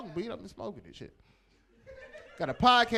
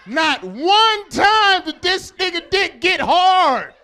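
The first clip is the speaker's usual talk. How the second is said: high, very loud, slow and stretched out; incredulous shout; 8 semitones higher, 13 dB louder, slower than usual. Acted. Comic marveling at the naked male contestant, incredulous shout. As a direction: belt out every word in disbelief.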